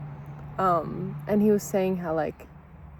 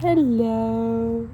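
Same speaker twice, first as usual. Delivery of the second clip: fond greeting; 3 semitones higher, 4 dB louder than usual. Real, not acted. Owner greeting her two dogs lying nearby, fond and gentle.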